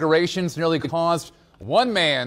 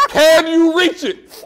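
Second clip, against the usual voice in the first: high, slow pleading mimic laughing; imitating the caller; 10 semitones higher, 7 dB louder, slower than usual. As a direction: squeak it out like the panicked man. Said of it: "he said".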